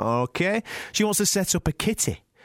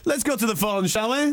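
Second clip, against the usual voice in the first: ordinary delivery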